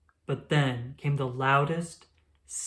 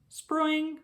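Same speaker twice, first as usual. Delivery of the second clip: a much higher springy boing; light sproing; 12 semitones higher than usual. Acted. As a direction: bounce it lightly. Leap sound word.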